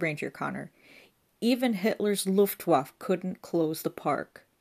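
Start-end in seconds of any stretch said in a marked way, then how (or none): 1.4-4.5 slow indignant emphasis. A narrator's reading. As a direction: drag it out indignantly. Ranger indignant.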